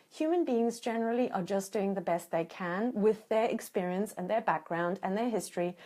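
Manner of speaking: ordinary talk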